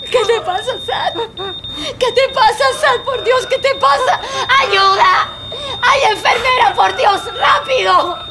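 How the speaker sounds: desperate pleas over a convulsing friend; panicked cry for help. Acted. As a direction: scream for help in terror.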